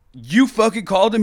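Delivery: high, loud disbelieving accusation; acted-out incredulous anger; retold indignant outburst. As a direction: snap it back in outraged disbelief. Retelling his outburst at the kid.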